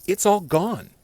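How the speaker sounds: telling off the boss, exasperated; exasperated outburst. Retold or imitated. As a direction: hit all hard in disbelief. Angry rant at boss.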